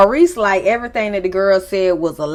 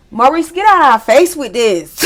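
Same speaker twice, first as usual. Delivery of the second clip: exasperated; 4 semitones higher, 6 dB louder than usual. Real, not acted. Exasperated at cast member.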